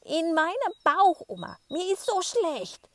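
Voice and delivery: loud, high wailing yell; bellowing with all his might. Acted. Boy bellows to grandma across the street, loud and high. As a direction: shout it across the whole street. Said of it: "brüllt er aus Leibeskräften".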